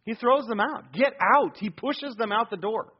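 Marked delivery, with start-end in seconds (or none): 0.9-1.6 a firm, loud "get out"; forceful order. Acted. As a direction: throw them out the door with your voice. Jesus throwing mourners out.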